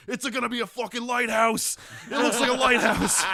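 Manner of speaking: loud, high, laughing punter calling his bet; excited laughing shout. Retold or imitated. Bar patron shouting his Bob Ross bet, laughing hard. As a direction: yell it through laughter like a winning bet.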